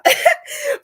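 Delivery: very high squeal of laughter; shrieking laugh. Real, not acted. Host cracking up at her own French, squealing laugh.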